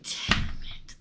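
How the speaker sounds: a whispered, annoyed damn it; whispered curse. Retold or imitated. Cursing herself for throwing the nozzles away, in a whisper. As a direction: breathe the curse under your breath.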